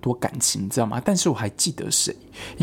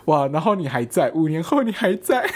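laughing through the words, higher; laughing disbelief; 3 semitones higher than usual. Retold or imitated. To the coworker still here years later, laughing in disbelief. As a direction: giggle out your amazement.

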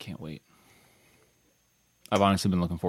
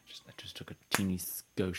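whispering; 12 dB quieter than usual. Real, not acted. Whispered aside while pouring.

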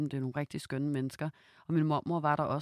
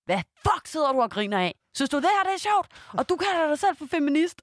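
much higher, louder furious reproach; angry yell at grandma; 12 semitones higher, 7 dB louder than usual. Retold or imitated. Scolding her laughing grandmother at the birthday, loud and furious. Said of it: "får i hvert fald bare sagt til hende".

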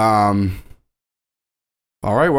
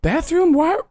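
questioning character. Acted. Game master mimicking questioning character.

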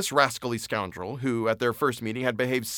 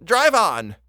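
loud, higher cry of despair; desperate shouted command; 6 semitones higher, 6 dB louder than usual. A narrator's reading. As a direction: cry out as if all is lost. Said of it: "cried in a despairing voice".

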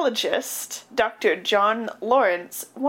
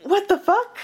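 mock shocked; 9 semitones higher, 3 dB louder than usual. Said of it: "they're like".

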